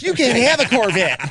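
high, loud mock psycho outburst; lame psycho. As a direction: blurt it like a comically lame psycho. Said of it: "It's lame psycho. It's like".

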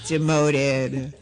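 ordinary talk, nothing marked

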